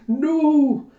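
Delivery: high, loud drawn-out "no"; panicked shout. A narrator's reading. Villager crouches before the unmasked blue dragon, panicked shout. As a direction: cry out in acted dread.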